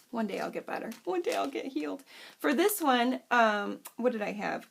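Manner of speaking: ordinary talk, nothing marked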